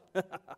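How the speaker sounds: brief chuckle after a triumphant outburst; real short chuckle; short laugh. Real, not acted. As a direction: let out a quick, pleased laugh. Laughs after his climax.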